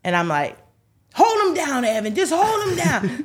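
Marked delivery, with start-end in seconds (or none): 1.1-3.2 much higher, louder panicked order; frantic yell. Retold or imitated. Mom yelling at her son to pin his brother, frantic loud shout. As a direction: shout it while fighting for control.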